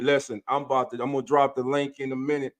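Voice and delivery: ordinary talk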